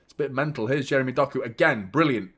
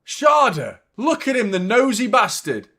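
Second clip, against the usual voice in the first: calling out; 6 semitones higher, 4 dB louder than usual. Real, not acted. Calls out a spying figure.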